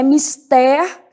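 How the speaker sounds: mister said as mis-TÉR; stress on last syllable. Shown as a demonstration. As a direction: land on an open TÉR in mister. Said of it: "elas são oxítonas".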